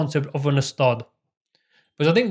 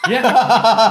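higher and louder yeah through laughter; laughing yeah; 8 semitones higher, 8 dB louder than usual. Real, not acted. Answering his brother mid-laugh, loud and high. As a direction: let the yeah ride a laugh. Quoting own reply while laughing.

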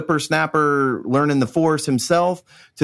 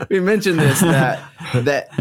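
words bubbling with laughter; laughing while talking; 4 semitones higher than usual. Real, not acted. Host laughing at the Florida boy joke. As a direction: keep laughing through the line.

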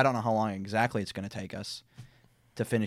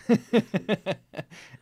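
loud laugh; 12 semitones higher, 6 dB louder than usual. Real, not acted. Host laughs at own joke.

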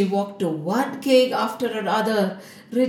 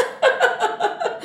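high, airy burst of giggles; high acted laugh. Vishwamohini rejecting Narad Muni, high mocking laugh. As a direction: laugh in the suitor's face. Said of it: "She again laughed".

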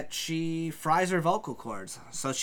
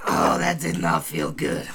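low, loud hurt voice; pained low groan; 12 semitones lower, 5 dB louder than usual. Acted. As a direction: moan it out after the fall. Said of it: "you just hear her go".

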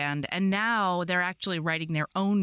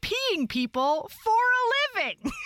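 incredulous; 11 semitones higher than usual. Real, not acted. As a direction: rant at your own slowness. Mock outrage at herself.

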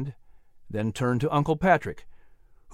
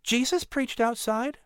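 put-on higher boy's voice, slow and plain-spoken; matter-of-fact; 10 semitones higher, 4 dB quieter than usual. A narrator's reading. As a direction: state it as simple fact, no fuss. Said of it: "Patrick answered very matter-of-factly".

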